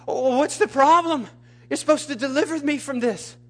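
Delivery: high-pitched acted whine to God; re-enacted indignant plea; exasperated complaint. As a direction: protest in a high, frustrated voice.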